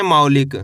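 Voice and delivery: मौलिक as one joined word, pitched low; model pronunciation. Shown as a demonstration. Teacher shows learners how to read it, मौलिक low and joined. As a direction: join मौ and लिक, dropping the pitch. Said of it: "कैसे पढ़ेंगे?".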